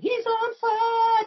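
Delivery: drawn-out, high-pitched announcer catchphrase; mock hype. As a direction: stretch it out high like a game announcer. Arcade catchphrase shouted in hype.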